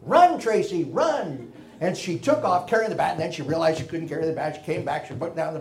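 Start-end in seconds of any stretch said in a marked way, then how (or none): 0.0-1.5 higher, louder call of run; shouted encouragement. Retold or imitated. Teammate calling Tracy to run, shouting. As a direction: call her on like a teammate from the field. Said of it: "somebody shouted".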